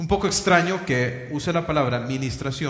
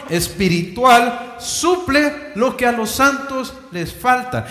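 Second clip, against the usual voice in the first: raised, louder preaching at full force; fervent preaching; 6 semitones higher, 6 dB louder than usual. Acted. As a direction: drive the point home to the congregation.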